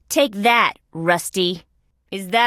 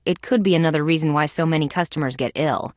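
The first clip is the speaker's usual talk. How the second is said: usual speaking voice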